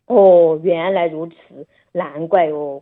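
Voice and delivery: a drawn-out, put-on "oh, I see" to play along; feigned surprise. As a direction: act amazed to match the child's mood. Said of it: "我就会，会装作那个声音嘛，这个语调嘛".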